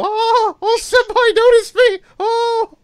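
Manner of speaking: shrill screeching fangirl, high and loud; excited anime fan. Retold or imitated. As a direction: screech it like a swooning fan in the front row. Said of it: "Molly and Aria Diamond screeching in the front row".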